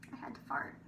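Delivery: hushed confession, breath only; whisper. Real, not acted. Confiding to listeners the embarrassing need, whispered. Repeated as a secret.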